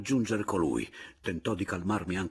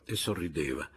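ordinary delivery, nothing marked

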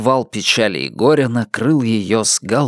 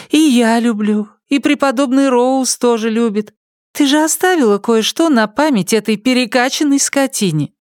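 high, cooing, sugary consoling voice; comforting gossip friend; 12 semitones higher than usual. Acted. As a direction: soothe her with fake sweetness. Irene comforts sobbing Sally.

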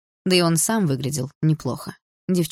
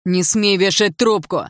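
slow, raised, angry command; yelling; 4 semitones higher, 4 dB louder, slower than usual. A narrator's reading. Young man ordering the girl not to hang up, yelling. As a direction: yell it down the phone, each word weighty. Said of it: "заорал Лайм".

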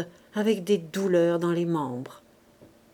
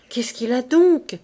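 louder, rising panic on the repeat; frightened repeat; 4 semitones higher, 6 dB louder than usual. Acted. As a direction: push the fear into "donc". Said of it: "répétait Gervaise, prise de taf".

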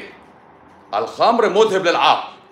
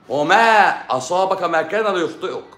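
measured, higher recitation of the saying; recited hadith; 4 semitones higher than usual. Shown as a demonstration. Preacher reciting the hadith to the viewer, solemn intoning.

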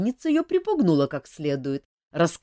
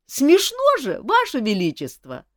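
high, cheeky laugh in the voice; amused jester; 8 semitones higher, 5 dB louder than usual. Acted. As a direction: tease the king openly.

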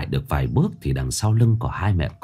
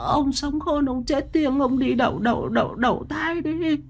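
pleading prayer. Acted. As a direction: plead with the dead husband's spirit. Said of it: "Mụ Mão chắp tay".

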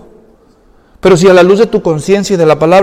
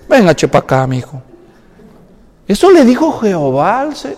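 usual speaking voice

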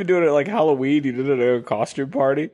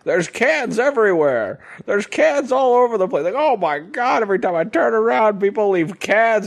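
much higher, hammed-up exasperation over cans; exasperated host; 8 semitones higher than usual. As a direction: complain theatrically about the mess. Exasperated host complaining about cans.

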